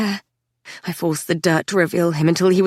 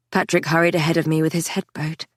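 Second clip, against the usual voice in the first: ordinary delivery, nothing marked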